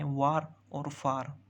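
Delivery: वार और फार drawn out to show the shared ending; slow demo of the rhyming pair वार और फार; slow rhyme demo. Shown as a demonstration. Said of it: "इनमें same syllable है".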